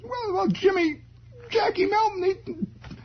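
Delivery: put-on very high nervous boy; floundering kid. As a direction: squeak out excuses.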